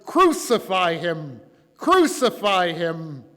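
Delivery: slow, loud crowd chant in narration; shouting. A narrator's reading. Crowd demands execution from Pilate, shouting. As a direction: hurl each demand out, loud and drawn out. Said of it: "but they kept shouting".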